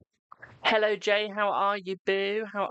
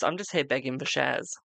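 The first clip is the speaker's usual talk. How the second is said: ordinary delivery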